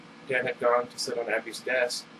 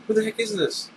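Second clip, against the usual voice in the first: startled; 10 semitones higher, 4 dB louder than usual. Acted. Startled by note under door.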